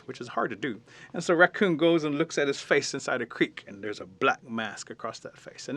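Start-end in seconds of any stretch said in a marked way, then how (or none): none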